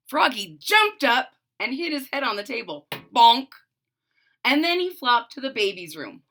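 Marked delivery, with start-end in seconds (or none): 3.1-3.5 loud bonk effect. A narrator's reading. Sound effect.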